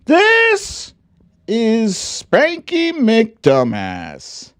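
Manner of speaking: put-on high, slow read-aloud children's book voice; storybook reading. Streamer reading a parody children's book to viewers in a high, slow storybook voice. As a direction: read it like a bedtime picture book, sing-song.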